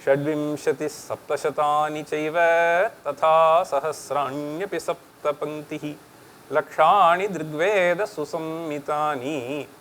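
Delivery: chanting a Sanskrit verse, brisk; quick Sanskrit verse recital; verse recitation. Real, not acted. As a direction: recite the shloka in a steady chant. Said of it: "I found an interesting verse which gives this".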